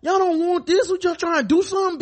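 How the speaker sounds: high, loud mock New York tough guy; posturing bravado, high and loud; tough New Yorker. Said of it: "He was like".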